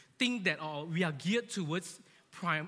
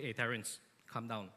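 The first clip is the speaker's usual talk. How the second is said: lower, softer, firm boss voice; stern boss; 7 semitones lower, 5 dB quieter than usual. Retold or imitated. Boss on the phone to a scared employee, low stern. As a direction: lay down the law, low and cold. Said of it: "Then your boss say".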